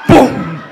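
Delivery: loud, abrupt "pum"; short booming gun noise; imitated gunshot. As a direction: fire off a loud vocal bang.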